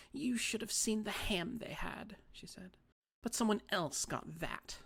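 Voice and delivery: put-on a wronged old lady sulking; fussy little old lady. A narrator's reading.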